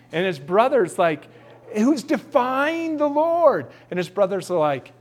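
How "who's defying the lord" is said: higher, slow outraged question; young David scoffing at the giant; indignant young David. Acted. David asking his brothers about Goliath, higher and slow. David voiced in Bible story.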